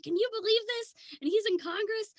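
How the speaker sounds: high, loud gossipy friend voice; excited friend. Retold or imitated. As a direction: ham up a breathless gossiping friend. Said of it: "she's like".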